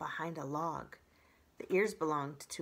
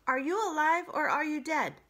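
higher, louder call down from a tree; 10 semitones higher, 8 dB louder than usual. Acted. Robot calling from the tree to the creature, louder. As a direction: call down into the dark. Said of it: "The robot's voice called down".

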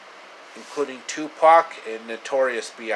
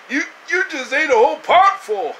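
very high, loud squeaky cartoon voice; high cartoonish squeal with laughter; 11 semitones higher, 6 dB louder than usual. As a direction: play a silly squeaky sidekick. Character voices arguing about beans.

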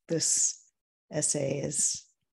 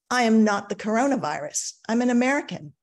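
higher and louder, stung and defiant; indignant retort; 6 semitones higher, 5 dB louder, faster than usual. Retold or imitated. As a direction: push back hard, you've been insulted. Her retort to the man.

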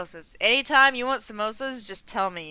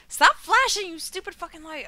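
high, loud startled snap at a light; angry yell; 9 semitones higher, 5 dB louder than usual. Real, not acted. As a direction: shout at the flashing light. Yells at flashing light.

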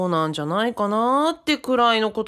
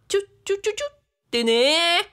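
kiss noises; 8 semitones higher than usual. Retold or imitated. Recalling the kiss.

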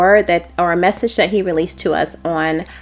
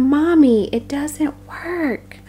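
put-on crying, complaining child; 6 semitones higher, slower than usual. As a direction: plead to Mommy like a let-down child, trailing off. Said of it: "hear him crying and complaining".

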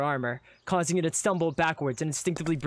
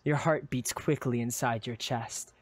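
usual speaking voice

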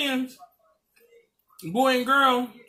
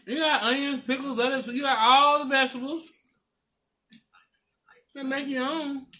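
speaking between bites; talking while chewing. Real, not acted. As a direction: mouth partly full of food. Seen 1: bite taken at -1.0, mouth working while she talks.

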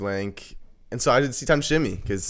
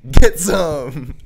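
loud, high-pitched mock battle cry; hammed-up shouted catchphrase; imitated video shout; 6 semitones higher, 11 dB louder than usual. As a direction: belt it out, imitating a viral video. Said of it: "some person just saying".

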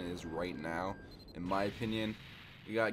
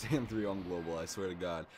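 ordinary delivery

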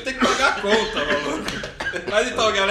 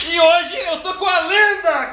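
hyped announcement; 6 semitones higher, 5 dB louder than usual. Real, not acted. Host hyping up his guest.

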